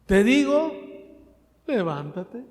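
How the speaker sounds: Jesus commanding the dead to rise. Acted. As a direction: order him to rise. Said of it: "dijo Jesús".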